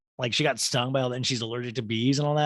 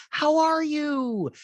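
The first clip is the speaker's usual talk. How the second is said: very high, slow, cracking greeting; high cracky voice; 4 dB louder, much slower than usual. Retold or imitated. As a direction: let the voice go up and crack. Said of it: "In this voice ... really high and cracky".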